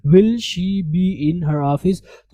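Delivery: very slow, word by word; slow model sentence. Shown as a demonstration. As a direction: read it out slowly for learners. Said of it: "जैसा कि आप यहाँ देख सकते हैं".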